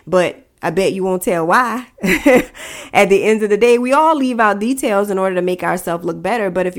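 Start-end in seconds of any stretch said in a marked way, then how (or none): 1.9-2.9 short laugh. Real, not acted. Laughs after her own jab.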